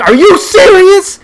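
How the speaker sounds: very high, loud, slow disbelieving shriek; mom shouting. Retold or imitated. Mom at the kitchen window shrieking at the family, outraged shout. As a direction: screech it like a startled mom at the sink. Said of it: "my mom's like".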